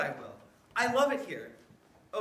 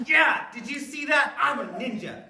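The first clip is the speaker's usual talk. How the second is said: excited brag; 4 semitones higher, 12 dB louder than usual. Acted. Boy proud after chopping.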